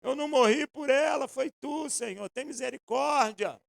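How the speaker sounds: mock a weary pastor's prayer; a hint of a desperate pastor; pastor pleading. Pastor voiced pleading God.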